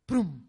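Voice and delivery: quick low burst; mock pop sound. Sound effect of passing.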